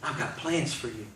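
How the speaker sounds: low, soft promise; hushed divine voice. Retold or imitated. God promising a despairing man a future, hushed.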